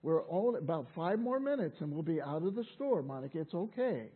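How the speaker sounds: soft, low reassurance; soothing mother. Retold or imitated. Mother reassuring Monica as the child cries, soft and kindly. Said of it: "the voice of the mother saying".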